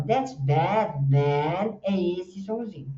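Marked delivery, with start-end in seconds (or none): 0.0-1.8 "That's Batman" very slowly, stretching each æ; English æ vowel. Shown as a demonstration. Teacher quotes films to show students, English æ vowel. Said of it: "Nos filmes, quando eles falam".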